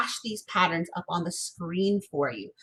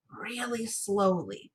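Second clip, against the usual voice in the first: "really slowly" stretched out and softer; drawn-out slowness; 4 dB quieter, slower than usual. Shown as a demonstration. Teacher urging careful practice, "really slowly" drawn out. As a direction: draw out "really" to show slowness.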